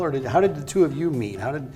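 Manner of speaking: usual speaking voice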